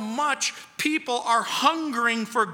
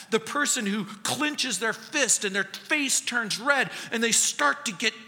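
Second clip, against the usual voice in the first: normal talking voice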